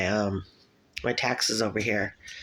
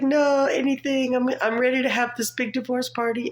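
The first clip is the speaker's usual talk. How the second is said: eager mock plea; 12 semitones higher than usual. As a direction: plead it with giddy eagerness. Acted plea, eager for divorce.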